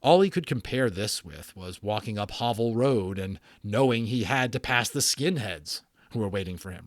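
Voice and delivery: ordinary delivery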